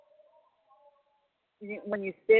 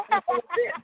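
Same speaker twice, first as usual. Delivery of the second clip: laughing; 5 dB louder, faster than usual. Real, not acted. Laughing after telling the story.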